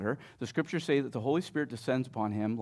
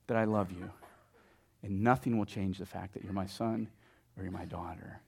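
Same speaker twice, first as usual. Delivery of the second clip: low, softened, sincere; tender reassurance; 6 semitones lower, 7 dB quieter than usual. Acted. Dad reassures child after timeouts, tenderly. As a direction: drop down gently and let it settle.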